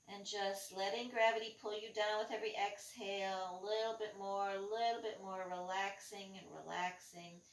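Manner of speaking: guided meditation. Acted. Guided relaxation while hanging down.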